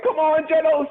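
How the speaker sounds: very high, strained mock yell; hammed-up frantic hurry; urgent calling out. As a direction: call to a sidekick while taking off running. Retold hero calls his sidekick along.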